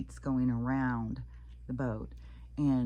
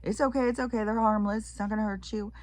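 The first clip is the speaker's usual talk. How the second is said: higher, louder calm-down voice; reassuring guide; 8 semitones higher, 4 dB louder, faster than usual. Retold or imitated. Tour guide calming a panicking tourist, lightly voiced. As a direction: soothe the screaming tourist. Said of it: "he's like".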